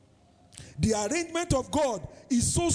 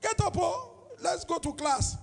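friend urging; 10 semitones higher than usual. Retold or imitated. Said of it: "He said".

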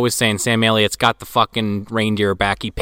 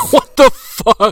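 higher, louder and slow; stunned disbelief; 12 semitones higher, 9 dB louder, slower than usual. Real, not acted. Host reacts to the cheap green glow, loud and high. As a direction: drag out the shock.

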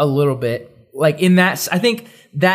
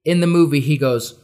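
ordinary talk, nothing marked

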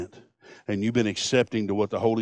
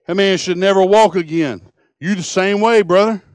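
raised preacher's voice; building sermon fervor; preaching; 6 semitones higher, 7 dB louder than usual. Acted. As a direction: drive it home to the congregation. Preaching with rising force.

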